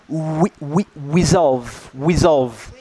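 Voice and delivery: resolve said as wesolve, w replacing the r. Shown as a demonstration. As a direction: round the lips into a w on resolve. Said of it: "Look, it says, "Resolve" becomes".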